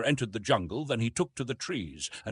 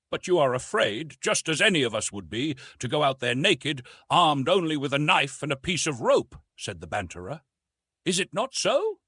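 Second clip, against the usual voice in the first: higher, lightly voiced teasing Frenchman; teasing banterer; 6 semitones higher, 4 dB louder than usual. A narrator's reading. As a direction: needle him with a grin. Banterer taunting Tarzan.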